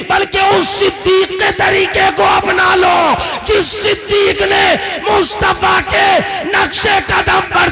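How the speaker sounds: high, sustained shouted sermon; full-force preaching. Acted. Preacher urging the crowd to follow Siddiq's way, full-force preaching. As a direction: drive each phrase out at full cry.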